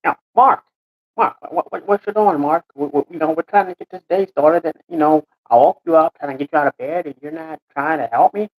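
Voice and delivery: lightly voiced frustrated character, stammering; exasperated Scott. Scott trying to get Mark out of bed, exasperated.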